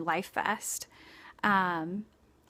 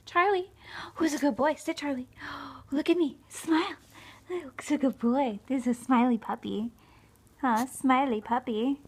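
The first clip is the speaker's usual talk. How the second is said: high sing-song praise for a puppy; 5 semitones higher than usual. Real, not acted. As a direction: coo at the dog to make him smile. Baby talk to dog for smiles.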